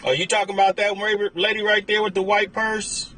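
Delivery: normal talking voice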